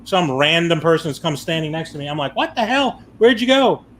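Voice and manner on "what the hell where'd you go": alarmed shout. Retold or imitated. Lost his wife, panicking.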